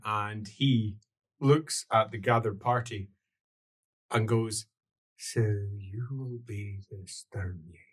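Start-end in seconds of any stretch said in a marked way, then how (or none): 5.2-7.9 low, soft elf voice; hushed, lowered greeting; less shouty. Acted. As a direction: speak low and measured to strangers. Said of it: "Less shouty, though".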